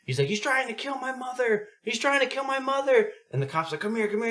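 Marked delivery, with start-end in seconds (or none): 0.3-3.1 high-pitched imitation of a panicked kid; frightened child. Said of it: "other older kid's probably eight or nine". 3.8-4.3 urgent cop. Retold or imitated. Cop calling kids to safety.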